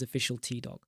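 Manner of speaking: ordinary talk, nothing marked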